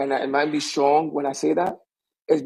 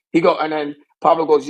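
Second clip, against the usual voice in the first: ordinary delivery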